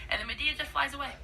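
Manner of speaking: ordinary talk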